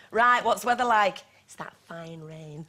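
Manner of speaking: low, slow comic phone-home impression; Peter Kay. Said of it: "I love it when Peter Kay does that".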